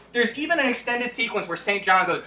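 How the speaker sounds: normal talking voice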